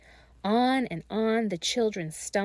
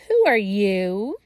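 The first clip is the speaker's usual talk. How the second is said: croaking; 7 dB louder, slower than usual. Acted. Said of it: "she croaked".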